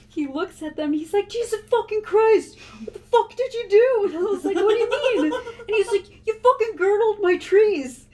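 high, loud, hammed-up angry yell; mock furious grandpa. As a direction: blow up at the grandson over his trees. Grandpa yells about girdled trees.

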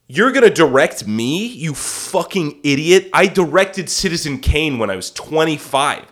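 loud, incredulous scolding; indignant outburst. Retold or imitated. Speaking as Orson to the director, angrily indignant. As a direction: pull rank with mock outrage.

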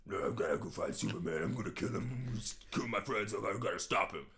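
rough, brooding hero caricature; Batman. Acted. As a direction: vow revenge in a put-on vigilante voice. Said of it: "all of Alfred and Batman's scenes".

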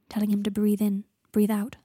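ordinary delivery

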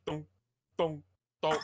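deadpan mouth-made thunk noises; thunk sound effects. Retold or imitated. Retelling the man hitting the door, making thunk sounds. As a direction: say each thunk as a dull knock. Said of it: "making the sound effects".

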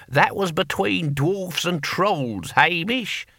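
long-suffering, drawn-out explanation; sighing. A narrator's reading. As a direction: spell it out slowly to an old comrade. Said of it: "Boy Willie sighed".

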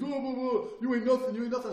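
high, loud mock taunting; talking trash. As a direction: imitate a mouthy guy jeering. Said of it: "He was talking shit".

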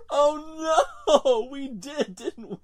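oh no laughed out high and loud; laughing. Real, not acted. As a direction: squeal through real laughter. Laughing at own repetition.

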